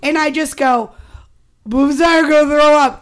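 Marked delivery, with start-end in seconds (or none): 1.7-3.0 loud, rushed, higher-pitched outburst; urgent blurt. Retold or imitated. Guest re-enacting the moment before vomiting, urgent loud blurt. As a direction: blurt it all in one burst.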